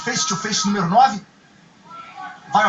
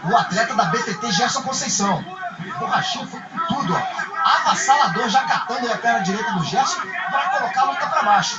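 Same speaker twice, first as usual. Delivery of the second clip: higher, rising with the action; excited play-by-play; 7 semitones higher than usual. Acted. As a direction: call the flurry with urgency. Play-by-play of fighter flurry.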